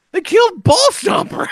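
high, slow wailing lament, half laughing; mock grief. Telling his wife the horse died, mock anguished wail. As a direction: wail it like a hammed-up mourner. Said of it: "I go".

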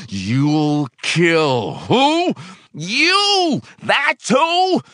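high, loud, slow angry shout; shouting back. A narrator's reading.

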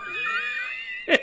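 whooping laugh at the punchline; big laugh. Real, not acted. Guest's story punchline.

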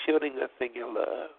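ordinary delivery

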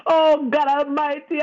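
ordinary talk

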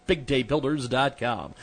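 ordinary talk

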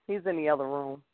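said again under the breath; quiet repeat. Real, not acted.

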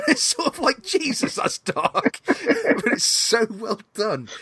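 high laughing reaction. Real, not acted. Laughing at dark film joke.

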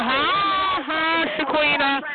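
high, eager interruption; excited greeting. Real, not acted. Spotting Shaquita back on the line, high excited greeting. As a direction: jump in up high to hail them. Rival returns, caller breaks off to greet.

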